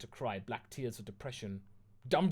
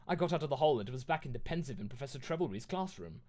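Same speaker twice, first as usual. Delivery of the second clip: usual speaking voice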